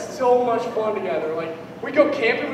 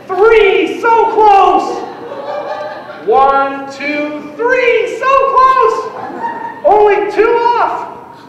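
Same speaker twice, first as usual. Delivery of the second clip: 1 excited counting; 10 semitones higher, 7 dB louder, much slower than usual. Retold or imitated. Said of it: "I was just like".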